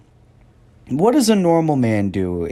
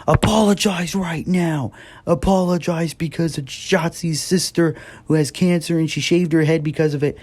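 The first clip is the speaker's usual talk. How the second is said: mock fans demanding; 7 semitones higher than usual. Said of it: "people being like".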